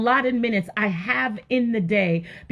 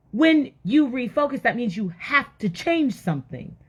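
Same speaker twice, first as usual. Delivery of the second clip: normal talking voice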